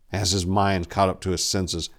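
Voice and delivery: ordinary delivery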